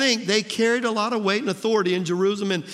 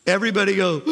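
crowd gasp; 3 dB louder, much faster than usual. Retold or imitated. Preacher mimics the crowd's shocked gasp. Crowd reacts to someone fainting.

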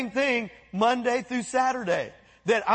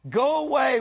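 higher, louder cry of the possessed man; 5 semitones higher, 5 dB louder than usual. Retold or imitated. Possessed man crying out to Jesus, louder shout. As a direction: cry it out at the top of his voice. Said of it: "He cried out at the top of his voice".

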